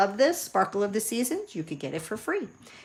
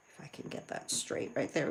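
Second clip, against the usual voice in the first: whisper; 5 semitones lower, 13 dB quieter than usual. Real, not acted. Concentrating while cutting.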